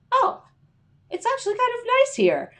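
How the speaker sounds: very high squeaky delight; mock delight. Writer arriving somewhere good, in squeaky delight. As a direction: squeal it out, pleasantly surprised. Voicing surprised comfort, very high.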